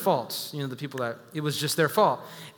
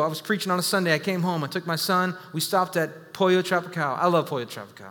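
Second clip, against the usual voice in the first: ordinary talk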